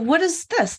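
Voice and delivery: ordinary delivery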